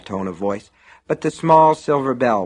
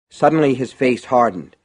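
ordinary delivery, nothing marked

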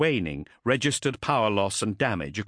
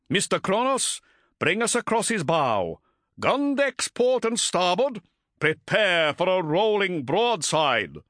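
high, ringing battle orders; captain ordering a broadside; commanding; 9 semitones higher than usual. A narrator's reading. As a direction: call out orders to the whole bridge, slowing to the end. Commander calling battle orders to crew.

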